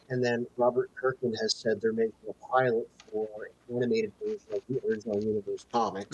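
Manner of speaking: muffled audio, like through a wall; muffled bad mic sound. Real, not acted. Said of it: "you're sounding, like, muffled".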